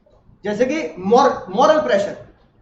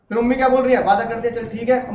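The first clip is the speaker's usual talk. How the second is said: usual speaking voice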